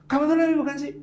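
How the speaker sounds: high, clipped exasperated jab; angry jealous retort. Retold or imitated. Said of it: "untuk marah kepanjangan".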